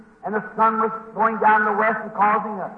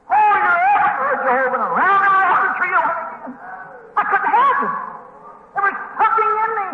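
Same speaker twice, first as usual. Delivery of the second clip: high, ecstatic holy cries; breathless-with-joy revival holler; hollering in praise; 8 semitones higher than usual. Retold or imitated. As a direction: let it burst out in high-pitched praise. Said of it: "screaming and running and hollering as hard as I could".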